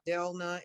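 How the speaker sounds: DELNA in two syllables, DEL-nuh; mispronounced name. Retold or imitated. Guest listing names teachers called them, mispronounced "Delna" as DEL-nuh. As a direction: say "Delna" with the middle vowel dropped. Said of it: "things that I was called".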